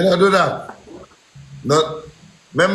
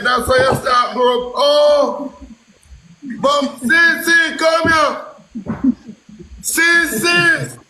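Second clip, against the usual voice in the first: shouting; 6 semitones higher, slower than usual. Real, not acted. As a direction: yell it out, getting higher. Shouting, rising.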